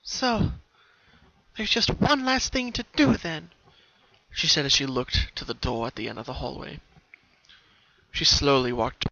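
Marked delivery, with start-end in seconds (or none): 0.0-3.5 put-on high, lively young pony voice; Pinkie voice. Pinkie, resolved, speaking to herself at the door, high and lively.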